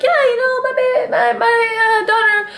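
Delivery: high-pitched imitation of a casual grandma; mock casual. Imitating a grandma chatting about her daughter, mock casual. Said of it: "she just was casually".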